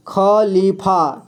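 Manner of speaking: Khalifa drawn out slowly, syllable by syllable; slow modelling. Shown as a demonstration. Teacher reads Khalifa slowly to the class. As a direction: spell Khalifa out by ear, very slow. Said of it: "क्या पढ़ेंगे?".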